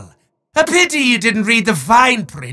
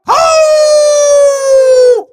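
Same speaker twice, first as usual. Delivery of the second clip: much higher, louder shrieking cry; a sudden theatrical screech; villain shriek; 7 dB louder than usual. Acted. Demon exclaiming before calling the mortal a fool, shrieking.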